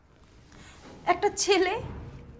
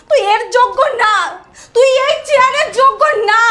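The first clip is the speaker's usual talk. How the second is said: raised, louder furious outburst; angry scolding; 4 semitones higher, 9 dB louder than usual. Acted. Sister telling brother he is unworthy, angry shouting. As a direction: berate him loudly, voice pitched up.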